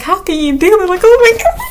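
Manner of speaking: high squeal of disbelief at the bug. Retold or imitated. Recalling her ex killing bugs, a high aghast squeal. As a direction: pitch it way up, aghast. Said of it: "I was like".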